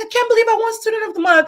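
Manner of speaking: put-on high and fast, amazed kid's disbelief; excited kid. Teacher imitating her proud student, high and fast. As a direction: bounce it up like a boy who can't believe he won.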